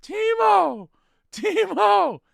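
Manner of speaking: high, loud shout; yelling. Retold or imitated. Fan yelling for Timo in round seven, high and loud. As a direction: shout the name with everything you have. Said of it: "I'm like".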